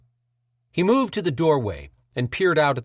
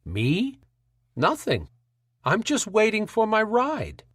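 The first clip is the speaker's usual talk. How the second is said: feigned hurt; 8 semitones higher, slower than usual. A narrator's reading. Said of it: "in a tone that feigned hurt feelings".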